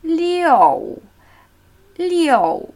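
"Liu" glided high and falling, weight on its O; falling tone, stressed O. Shown as a demonstration. Teacher blending the vowels of six, falling tone. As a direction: stretch "Liu" from a high start and let it fall. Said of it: "Anmutig einfach".

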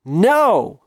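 loud high defiant cry; defiant shout. Acted. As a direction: shout it back with a laugh. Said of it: "laughed out".

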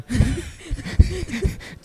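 high burst of laughter. Real, not acted. Laughing at own embarrassing story.

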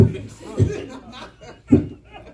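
real laugh while telling the story; hearty laugh. Real, not acted. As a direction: burst out laughing. Laughs at his own story.

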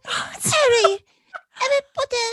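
very high, loud, gasping mock madame; Harry Potter French madame. Podcaster impersonating French madame greeting Harry, high and hammy. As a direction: squeal it in a hammed-up, breathless character voice. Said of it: "No, hers is like".

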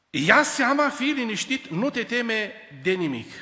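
high, loud and slow, a calm command; God speaking through prophet. Acted. Prophet to frightened king Ahaz, high and loud. God's prophecy voiced loudly.

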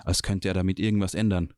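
ordinary delivery

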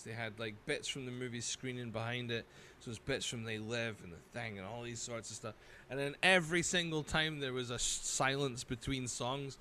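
ordinary talk, nothing marked

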